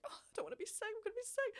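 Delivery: high, wobbling retold panic; mock panicked crying. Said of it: "And, like, I was crying".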